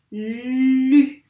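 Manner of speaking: emphatic teacher's "Lu"; sounds blended slowly. Shown as a demonstration. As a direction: belt a stretched "Lu", l then u. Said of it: "il y a le l et le u".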